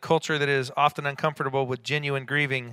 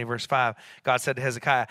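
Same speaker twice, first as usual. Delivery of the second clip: normal talking voice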